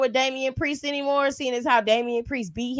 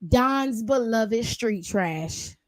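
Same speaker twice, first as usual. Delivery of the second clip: slow mockery; 3 semitones lower, 3 dB quieter, much slower than usual. Real, not acted. Slow mocking emphasis.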